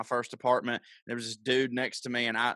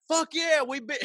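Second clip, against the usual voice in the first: hammed-up triumphant yell; mock proud cheer; 13 semitones higher than usual. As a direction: whoop it up like a mock local booster. Mock hometown pride, then rejected.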